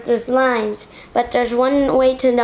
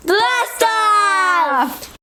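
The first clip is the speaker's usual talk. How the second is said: high excited cry; excited shout; 8 semitones higher than usual. Real, not acted. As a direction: launch it, all excited. Ends countdown.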